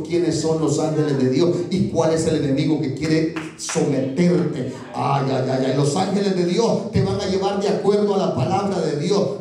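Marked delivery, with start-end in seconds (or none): none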